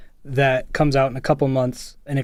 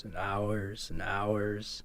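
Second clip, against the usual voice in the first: very slow, much softer, drawn-out hours; weary drag; 5 semitones lower, 13 dB quieter, much slower than usual. Real, not acted. Friend drags out the endless editing hours, very slow and soft. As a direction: stretch it out in mock exhaustion.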